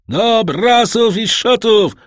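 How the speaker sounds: high, loud, slow shouted order; shouted command. A narrator's reading. Edmund orders the crew to the sheets, shouting. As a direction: bellow the order across the deck. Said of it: "krzyknął".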